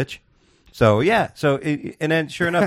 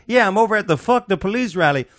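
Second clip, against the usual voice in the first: mock caller; 8 semitones higher than usual. Woman on 911 call.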